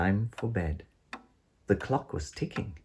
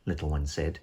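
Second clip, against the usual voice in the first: usual speaking voice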